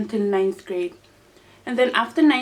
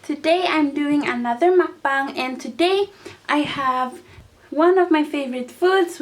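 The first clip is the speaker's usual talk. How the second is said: ordinary delivery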